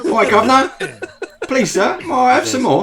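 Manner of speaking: high, loud mock Cockney urchin; worst English accent. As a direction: squeak out a hammed-up English accent. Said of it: "The worst English accent in the history".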